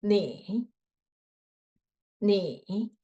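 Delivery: 你 said low in the throat, dipping; low third tone. Shown as a demonstration. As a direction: start 你 very low and dip it. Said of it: "make your voice low".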